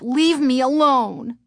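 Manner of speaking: high slow irritated snap; snapped. A narrator's reading. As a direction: snap at her sister. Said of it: "Chris snapped".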